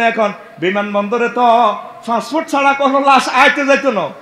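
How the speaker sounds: normal talking voice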